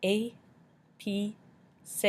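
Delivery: "A, P, C" as three separate sounds, ay, pee, say; sounded out by syllable. Shown as a demonstration. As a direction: pause between each of "A, P, C". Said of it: "making an A sound".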